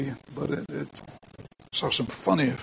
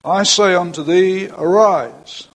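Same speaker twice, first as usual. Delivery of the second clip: loud command; 3 semitones higher, 8 dB louder, slower than usual. Retold or imitated. Jesus commanding the dead young man, a loud higher call. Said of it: "I'm sure he said it quite loudly".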